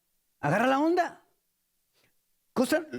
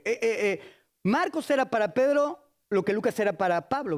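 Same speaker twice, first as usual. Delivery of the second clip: normal talking voice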